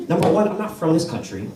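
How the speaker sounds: usual speaking voice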